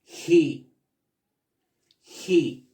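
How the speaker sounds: "He" as a firm "hee", repeated for learners; teacher's repeat-after-me "He", vowel held; pronunciation model. Shown as a demonstration. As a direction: drill "He" with a long ee, pausing between repeats.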